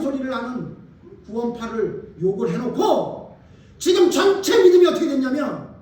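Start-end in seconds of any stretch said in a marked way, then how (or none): 3.8-5.7 loud, ringing build-up; preaching shout. Real, not acted. Pastor rebuking congregation, loud ringing shout. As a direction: raise the voice to seize them.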